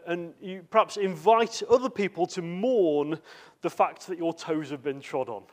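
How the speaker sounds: normal talking voice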